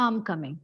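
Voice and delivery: soft, low merged "I'm coming"; natural contraction. Shown as a demonstration. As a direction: run "I'm" straight into COMing. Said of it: "It is".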